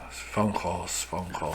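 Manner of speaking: very quiet, low imitation of a supervisor; low mumbled reply. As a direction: mutter it low, barely audible. Imitating weary supervisor's mumbled answer.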